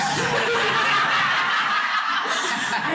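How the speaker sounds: long, high-pitched giddy laugh; real, high laughing outburst; overwhelmed laugh. Real, not acted. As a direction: squeal of laughter, overcome with excitement. Overwhelmed fan laughs while thanking hosts.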